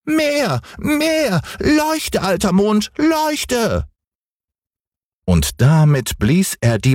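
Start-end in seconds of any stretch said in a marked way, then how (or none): 0.0-3.9 hohe, quengelnde Kinderstimme; kleiner Junge schreit. Acted. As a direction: fordernd wie ein ungeduldiges Kind. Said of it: "schrie Häwelmann". (hoch und fordernd gerufen)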